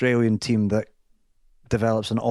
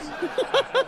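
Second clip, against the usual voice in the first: laughter. Real, not acted. Laughing at own story.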